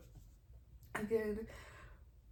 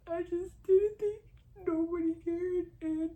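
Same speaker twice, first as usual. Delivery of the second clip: real crying; 8 semitones higher, 5 dB louder than usual. Real, not acted. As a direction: struggle to get it out through tears. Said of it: "literally crying like how I am".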